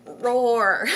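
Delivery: loud playful roar; mock roar. Mother mimicking her frustration, loud mock roar.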